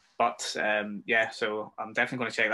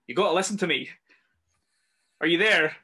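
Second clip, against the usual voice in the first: higher and louder urgent call over the radio; retold shout; 6 semitones higher, 6 dB louder than usual. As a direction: call out insistently, pitched up. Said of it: "is shouting".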